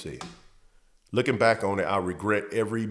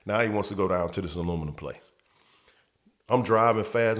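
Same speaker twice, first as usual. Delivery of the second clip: ordinary talk, nothing marked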